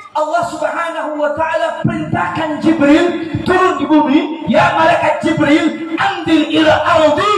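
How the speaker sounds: loud, high commanding proclamation; booming preaching. Acted. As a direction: thunder it out like a divine command. Preacher dramatises God commanding Jibril.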